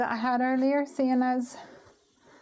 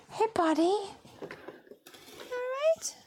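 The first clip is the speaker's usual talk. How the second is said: puppy talk; 12 semitones higher, 3 dB quieter than usual. Real, not acted. Greeting the puppy.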